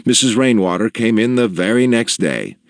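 usual speaking voice

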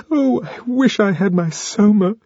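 high plaintive Lenina voice; long for it like a craving; high wistful whine; whiny wish. A narrator's reading. Upset Lenina longs for drug.

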